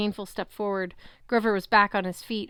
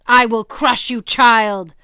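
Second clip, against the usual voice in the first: very loud bellow; booming titan bellow; bellowed threat; 3 semitones higher, 13 dB louder, slower than usual. A narrator's reading. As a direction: crush her with your voice. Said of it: "Kronos bellowed".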